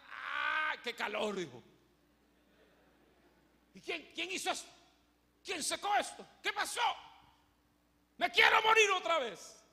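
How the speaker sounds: high, loud whining outburst; angry despairing wail. Acted. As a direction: wail like a spoiled man losing his shade. Jonás furioso por el calor. (queja furiosa y chillona, mucho más alta y fuerte)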